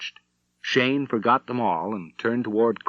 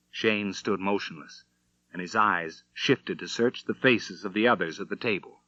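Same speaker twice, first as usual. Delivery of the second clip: plain reading voice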